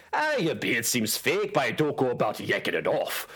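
put-on Scottish dwarf accent. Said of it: "if I could do a Scottish accent".

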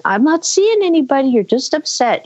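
high, breezy mock-mom voice; unconcerned mom. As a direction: imitate a mother casually dismissing her kid. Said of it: "still wandering through the house going".

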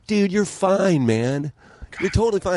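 high, slow, dismissive reassurance from drinking buddies; mock drinking buddies. Drinking friends telling him he's fine, high and slow. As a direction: stretch it out like a buddy waving off worry.

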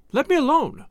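high-pitched nervous protest. A narrator's reading. Wife fending off her raging husband, nervously. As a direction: brush him off nervously, voice jumping up. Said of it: "she said nervously".

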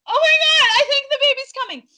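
very high, loud, fast alarm. Retold or imitated. Realizing the baby is coming, high alarmed cry. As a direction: gasp it out like the baby is here.

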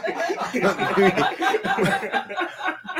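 long, high burst of laughter; amused laughter. Real, not acted. Host cracks up over the misunderstanding, laughing. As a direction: crack up helplessly.

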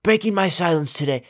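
mock dramatic. Mock dramatic apology announcement.

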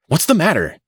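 high, alarmed question; appalled demand. A narrator's reading. Mister Button to the doctor, appalled. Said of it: "demanded Mister Button, appalled".

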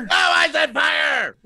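high, slow drawn-out yell; mock angry shout. Joking back at the co-host, a hammed-up angry shout. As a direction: stretch out the angry correction. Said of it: "so mad when he yelled it".

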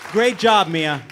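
booming game show host. Acted. Game-show host announcing winner.

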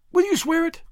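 very high, timid question; pleading. A narrator's reading. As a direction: plead for a promise in a small high voice. Frightened girl begs for an oath.

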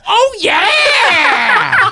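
high, loud drawn-out oh yeah; fake reaction. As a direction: shout it after the joke. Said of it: "tell a joke and go".

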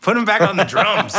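high, loud laughing jeer; laughing heckle. Pretend. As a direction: heckle him while cracking up.

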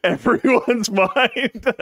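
slow, high, laughing words. Real, not acted. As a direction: laugh while you say it. Laughs at own mind-blowing joke.

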